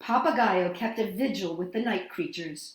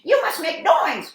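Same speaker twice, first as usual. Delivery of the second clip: high, loud urgent shout; 7 semitones higher, 8 dB louder than usual. A narrator's reading. Parrot rallying the night creatures, loud and high. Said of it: "he shouted".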